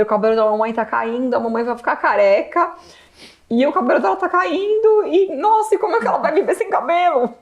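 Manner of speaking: high, crying voice rising; wife crying to husband, sobbing. Retold or imitated. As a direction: voice breaking with despair. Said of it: "eu chorava, chorava, chorava, eu soluçava".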